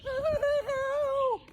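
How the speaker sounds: very high breathless cry; gasped cry for help. Acted. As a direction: gasp it out, drowning. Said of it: "he gasps".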